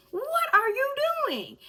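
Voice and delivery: high slow shocked cry. Retold or imitated. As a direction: gasp it out in disbelief. Shocked at the gun.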